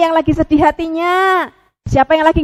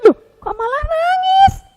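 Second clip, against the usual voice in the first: high startled cry of alarm; surprised puppet friend; 11 semitones higher than usual. Acted. Pupi startled as the penguin starts to cry, high. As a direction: gasp at the crying friend.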